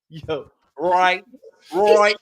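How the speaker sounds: loud mock Inglewood drawl; Inglewood homeboy accent. Imitating a homeboy from Inglewood, loud local accent. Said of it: "how you be talking?".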